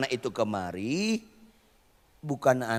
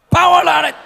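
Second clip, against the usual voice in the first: high, loud barked order; snapping command; 13 semitones higher, 14 dB louder than usual. Shown as a demonstration. Preacher leading the crowd to bark the command, loud and high. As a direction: bark it out sharply. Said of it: "Supaya lulus audisi kita ulangi".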